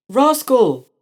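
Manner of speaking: high loud call of the name; loud cry. A narrator's reading. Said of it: "they cried out".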